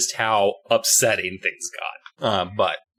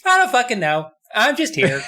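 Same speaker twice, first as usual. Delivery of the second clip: high, loud careless shrug; blunt kid voice; 11 semitones higher, 3 dB louder than usual. Retold or imitated. Susie brushes off her brother in a high bratty voice. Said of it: "She's just like".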